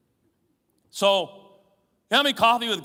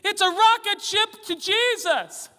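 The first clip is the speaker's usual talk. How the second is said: put-on higher, slow, eager child voice; excited little boy; 11 semitones higher, 4 dB louder than usual. Preacher imitating himself as a boy showing his drawing. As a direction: announce it like a proud kid.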